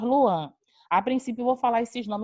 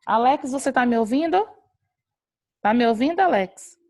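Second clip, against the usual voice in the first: louder, higher questioning call; 3 semitones higher, 6 dB louder than usual. Real, not acted. Teacher checking whether student Alex can hear her, calling out.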